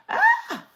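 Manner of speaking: sudden high-pitched squeal; quick excited squeal. Real, not acted. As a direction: let out a sharp high yelp. Sudden squeal mid rant.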